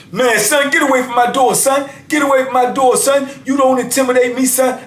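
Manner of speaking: high, defiant yelling; mock defiance. Inmate refusing to be scared off his door, high mock yell.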